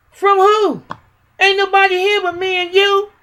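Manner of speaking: high-pitched, indignant disbelief; re-enacted high, baffled comeback; retold incredulous retort. Retelling her retort to officer.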